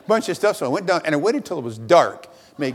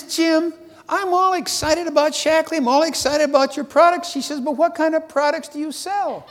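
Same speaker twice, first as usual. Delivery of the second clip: lady caller voiced much higher; 11 semitones higher than usual. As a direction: lift the voice high to play Mrs Walker.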